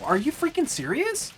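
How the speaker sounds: high-pitched, rising outraged disbelief; incredulous outrage. Real, not acted. Gamer protests his death, high rising outrage. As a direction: squeak it up in total disbelief. Right after dying in game.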